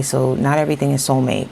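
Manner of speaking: ordinary delivery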